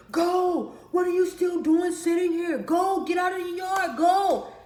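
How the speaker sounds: father shouting at his daughter to leave the yard; dad yelling. Retold or imitated. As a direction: yell to chase her out of the yard. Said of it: "yelled at me to get out of the yard".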